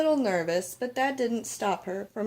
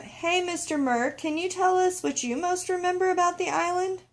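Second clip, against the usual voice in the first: calling out; 9 semitones higher than usual. Acted. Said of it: "Letitia called out".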